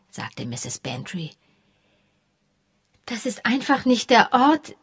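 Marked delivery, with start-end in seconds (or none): 3.1-4.8 higher, tentative start left unfinished; hesitant, breaking off. A narrator's reading. Josie puzzling over where the body lay, hesitant. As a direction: begin hesitantly and leave it hanging. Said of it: "begann Josie zögernd und unterbrach sich dann".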